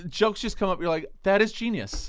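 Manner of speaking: high, loud gushing; real gushing excitement. Real, not acted. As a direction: rave about a show you adore. Gushing about a favourite show.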